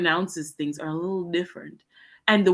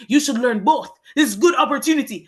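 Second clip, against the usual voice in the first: higher, louder, emphatic teacher; teacher's advice; 8 semitones higher, 8 dB louder than usual. Retold or imitated. A Japanese teacher firmly urges students to learn both spellings, emphatic. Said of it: "he's like".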